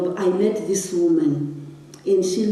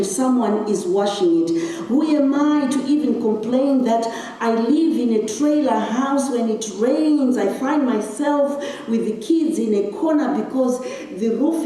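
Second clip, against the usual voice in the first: raised, ringing voice, stretched for emphasis; higher, fervent appeal, like an orator pleading; impassioned self-questioning; 4 semitones higher, 3 dB louder than usual. Retold or imitated. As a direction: build rhetorical questions with rising fervour. Impassioned self-questioning at speech's peak.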